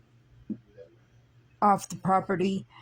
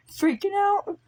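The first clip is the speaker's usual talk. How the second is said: panicked; 13 semitones higher than usual. Retold or imitated. Retelling panic at Jesus' arrival.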